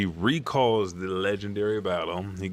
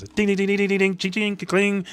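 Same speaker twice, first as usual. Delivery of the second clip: sword clang effects; 12 semitones higher, faster than usual. Retold or imitated. Swords clashing sound effects.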